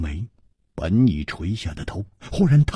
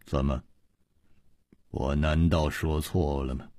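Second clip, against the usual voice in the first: low, quiet, unruffled question; calm, hushed counter to a raving man; calm reply; 8 semitones lower, 7 dB quieter than usual. A narrator's reading. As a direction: answer softly and evenly, pitched well down. Calm reply to wild laughter.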